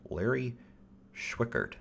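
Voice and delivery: ordinary talk, nothing marked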